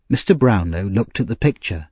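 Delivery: plain reading voice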